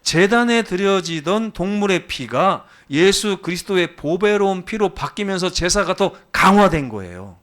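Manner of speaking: loud, high sermon climax; forceful preaching. Real, not acted. Preacher telling congregation how sacrifice was strengthened, forceful preaching. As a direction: proclaim it with weight and force.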